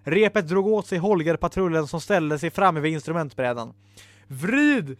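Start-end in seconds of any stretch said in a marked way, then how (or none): none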